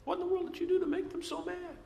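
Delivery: high, fast, disbelieving mother; scolding mom. Retold or imitated. Mother to her child after the kids got upset, high and disbelieving. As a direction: mom demanding what the kid did. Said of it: "and mom would say".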